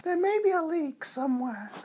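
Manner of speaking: very high squeaky voice; squeaky helium voice. Pretend. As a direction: chirp it like a helium balloon. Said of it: "really high-pitched squeaky voices".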